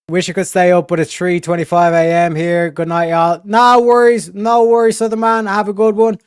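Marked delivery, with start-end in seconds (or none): 3.4-6.2 put-on Southern accent. Thanking Southern man on stream in a Southern accent. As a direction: play a Southern good ol' boy. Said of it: "Hopefully you enjoyed the accent".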